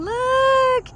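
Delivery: high, loud excited squeal. Real, not acted. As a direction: squeal it with delight. Showing the spot on the hike.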